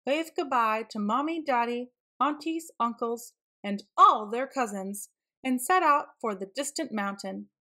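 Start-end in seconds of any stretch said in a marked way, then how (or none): none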